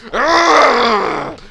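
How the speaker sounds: long, high exasperated growl; mock-rage groan at full volume; drawn-out frustrated roar; loud groan. Mock outburst at game character.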